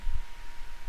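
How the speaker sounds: sharp breathy staged gasp; mock gasp. Friend hearing JoJo insulted, hammed-up gasp. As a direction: gasp as if deeply offended.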